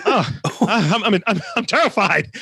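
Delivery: mock terror. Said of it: "I was like".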